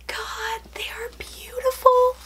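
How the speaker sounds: high, breathy whisper of awe; breathless whisper. Real, not acted. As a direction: whisper it, overcome. Overwhelmed by the gift.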